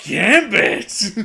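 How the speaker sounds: high, loud mock-swooning shout; swooning fan cry. Smitten fan calling out to Gambit, hammed-up swoon.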